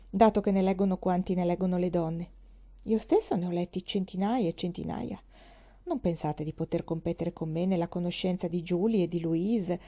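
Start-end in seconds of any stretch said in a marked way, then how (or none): none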